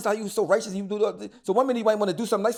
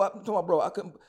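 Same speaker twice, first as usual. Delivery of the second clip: normal talking voice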